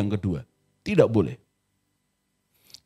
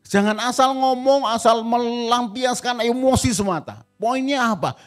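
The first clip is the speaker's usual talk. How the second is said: a hint of a huffy spouse, pitched higher; mock angry spouse; 9 semitones higher, 3 dB louder than usual. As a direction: voice the sulking partner, higher up. Preacher voicing angry spouse.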